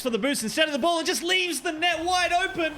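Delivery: climbing to a high pitch; sportscaster rising toward a goal; excited play call. Real, not acted. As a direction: build urgency as the chance opens up. Open net in live commentary.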